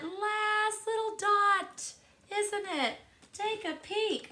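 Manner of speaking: rising up high with delight, slowed down; excited high voice. Real, not acted. Teacher marvelling to class at the clock, high and excited. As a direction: sound thrilled the hand is so close.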